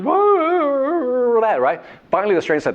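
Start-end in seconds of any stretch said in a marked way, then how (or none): 0.0-1.4 very high mimicked dog whine. Teller imitates the annoying dog, very high whine. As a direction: whine like a hurting hound dog. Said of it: "all you hear is".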